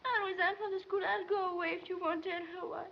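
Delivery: tearful bargaining. Acted. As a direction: beg desperately, close to crying. Girl begs to resign quietly.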